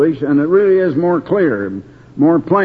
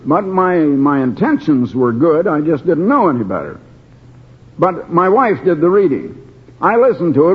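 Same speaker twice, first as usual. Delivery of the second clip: ordinary talk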